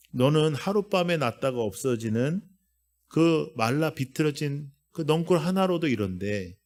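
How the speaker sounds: normal talking voice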